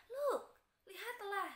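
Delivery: teacher acting out spotting something; excited look. Shown as a demonstration. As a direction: point something out eagerly. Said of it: "Kita bisa menggunakan".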